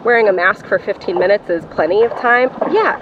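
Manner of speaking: normal talking voice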